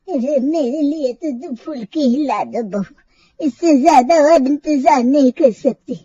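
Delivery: put-on slow, complaining old lady drawl; old woman voice. Grandmother complaining she has waited since morning, slow and aggrieved. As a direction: sound like a tired old grandmother kept waiting.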